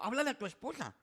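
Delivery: high, loud urging command; urgent order. Retold or imitated. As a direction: push him to call his wife. Urging panicked man to call. (orden urgente y alta)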